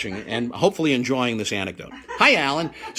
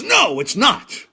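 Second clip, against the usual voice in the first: 1 indignant Jerry; 11 semitones higher, 7 dB louder, slower than usual. Retold or imitated. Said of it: "he goes".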